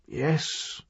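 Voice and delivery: very soft, fading yes; barely a breath, dying calm; weak whisper. A narrator's reading. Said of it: "His voice was weak".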